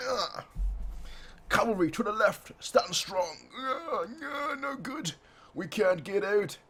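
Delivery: high-pitched, strained voice of soldiers in trouble; high-pitched mock panic. Voicing struggling soldiers.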